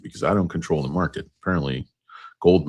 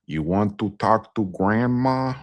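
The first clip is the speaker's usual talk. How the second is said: slow, higher put-on movie voice; grandma character impression; 6 semitones higher, slower than usual. Offering a talk with grandma, slow high impression. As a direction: draw it out, pitched up, in character. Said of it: "the grandma character".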